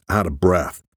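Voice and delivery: ordinary delivery, nothing marked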